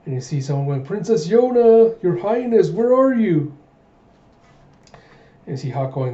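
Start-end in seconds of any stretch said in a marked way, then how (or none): 0.8-3.5 high, loud singsong calling; acted far-reaching shout; searching call. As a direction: call out for a missing princess, drawing out the name. Someone calls out searching for the princess.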